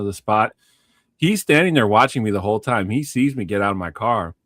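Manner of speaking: ordinary talk, nothing marked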